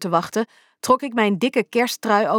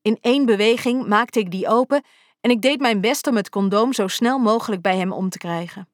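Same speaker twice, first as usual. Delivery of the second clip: usual speaking voice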